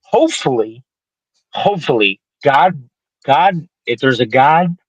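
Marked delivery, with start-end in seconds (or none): none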